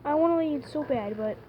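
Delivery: loud tearful plea; crying. Real, not acted. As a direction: cry it out loud. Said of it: "you're crying right now".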